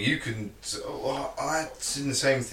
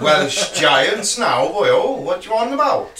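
loud, high mock Welsh voice; Welsh accent; 6 semitones higher, 6 dB louder than usual. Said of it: "my best Welsh accent".

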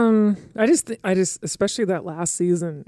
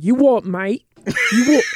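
put-on louder British accent, cheeky Cockney-style; British accent to the dog; 5 semitones higher, 4 dB louder than usual. Telling off the barking dog in a louder British accent. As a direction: play the London lad with a bit more volume. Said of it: "Yeah, I only say".

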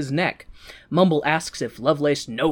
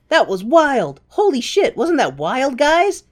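much higher, louder wide-eyed thrill; hammed-up excited penguin; excited Mumble; 9 semitones higher, 4 dB louder than usual. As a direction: gush like a kid after a ride. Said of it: "Mumble is like".

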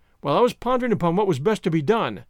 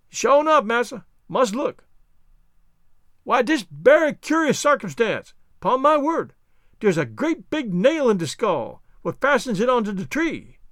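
higher dialect voice, marvelling; Jupiter, puzzled; 4 semitones higher than usual. Acted. Servant discovers nail in skull, higher wondering voice. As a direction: puzzle over the find as the servant.

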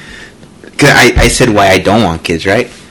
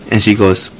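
normal talking voice

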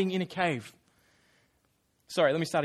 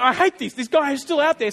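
louder, higher grumbling king; mock angry Saul; 5 semitones higher, 8 dB louder than usual. Preacher voices Saul's jealous rage, a touch louder. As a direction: play Saul fuming, raised voice. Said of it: "And he's like".

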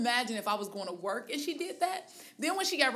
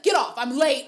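louder, snapped retort; mock snap; 3 semitones higher, 8 dB louder than usual. Woman voicing a rude commuter, a louder snapped retort. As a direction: bark it out, impatient. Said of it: "And then she was like".

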